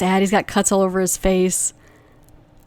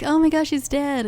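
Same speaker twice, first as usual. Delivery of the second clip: high, soft mock shock; mock gasp; 7 semitones higher, 4 dB quieter than usual. Corley reacting to the hanging, high mock shock. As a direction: gasp it out in hammed-up horror.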